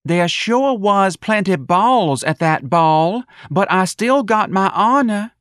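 high, slow, carefully placed words; careful Darlene. Acted. Darlene speaking carefully to her bird, high and slow. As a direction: lay each word down slowly like rehearsed lines. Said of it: "Darlene said carefully to the bird".

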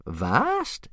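rising, gleeful interjection; happy interruption. Acted. Old Wrinkly cuts in on the chief, happy. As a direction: chime in happily. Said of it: "interrupted Old Wrinkly happily".

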